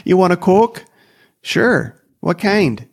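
high North Dakota grandma voice; sing-song North Dakota grandma. Retold or imitated. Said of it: "my grandma would ask me".

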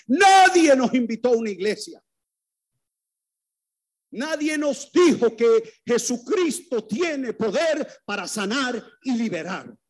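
preacher crying out to the church, impassioned; fiery preaching. Acted. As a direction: rouse the congregation to stop being silent. Preaches at full force.

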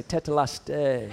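low, smug taunt; gloating to Jeff. Retold or imitated. Pastor taunting Jeff over ping pong, smug. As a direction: rub the win in playfully. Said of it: "I look at him and say".

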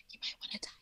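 unvoiced hushed warning; whisper. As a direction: whisper it like a secret. Kid whispering at school.

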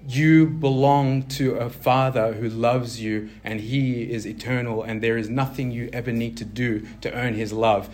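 ordinary delivery

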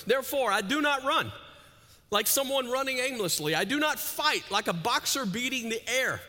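loud, high, preached reading of the verses; fired-up scripture reading; preaching scripture. Acted. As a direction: proclaim it to the whole house.

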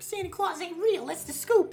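schoolkid voice. Retold or imitated. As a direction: play a smug schoolkid. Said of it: "everyone was like".

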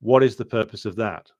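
normal talking voice

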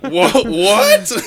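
high, loud, laughing disbelief; shocked. Retold or imitated. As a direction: stammer it out in shock. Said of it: "I just went".

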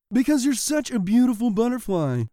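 gushing flattery; rabbits answering. A narrator's reading. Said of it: "they answered".

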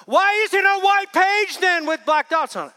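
exaggerated incredulous question; emphatic challenge. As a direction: push the couple to see it. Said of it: "And I'm like".

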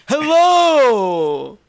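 loud, high, laughing "Hello!"; impression of Scott. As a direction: blare a greeting like your friend. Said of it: "That's you".

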